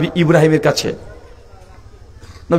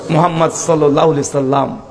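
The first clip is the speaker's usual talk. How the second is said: ordinary talk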